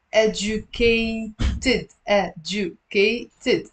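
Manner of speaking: "educated" very slowly, in separate syllables; slow dictation of "educated". Shown as a demonstration. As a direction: break "educated" into ed-u-ca-ted with gaps. Teacher spells out word by syllables.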